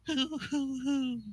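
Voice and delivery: put-on high, hooting old-lady giggle; new grandma laugh. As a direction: hoot a sweet little laugh. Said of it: "Her laugh definitely changed".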